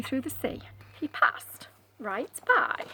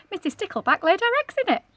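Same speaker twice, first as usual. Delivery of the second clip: ordinary delivery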